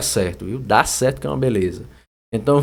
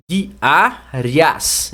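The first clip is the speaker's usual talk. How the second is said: "Diárias" in separate syllables DI, A, RIAS; syllable split. Shown as a demonstration. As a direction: pronounce "Diárias" one syllable at a time. Mostrando a divisão silábica. (sílaba por sílaba)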